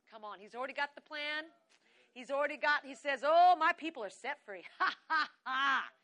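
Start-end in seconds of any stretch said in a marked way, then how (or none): 3.2-5.9 loud, triumphant acted laughter; booming victorious God voice, laughing. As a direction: proclaim it loudly, then burst into staged laughs. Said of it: "the Lord laughs at the wicked".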